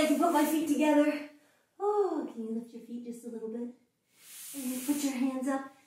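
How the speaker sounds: usual speaking voice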